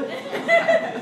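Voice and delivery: squeaky laugh burst; high burst of laughter. Real, not acted. Laughs at the threat.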